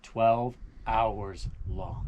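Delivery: drawn out, each word weighed; slow dramatic reveal. Acted. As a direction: let the answer land slowly. Guide reveals answer to guess.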